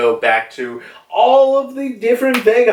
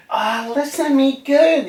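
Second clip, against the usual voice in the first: much higher put-on scolding voice; voicing Shaka; 13 semitones higher than usual. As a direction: lecture the boy. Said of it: "he's like".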